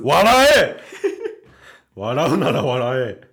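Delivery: playful fake anger, laughing; shouting through laughter; mock outrage. Teasing co-host for laughing.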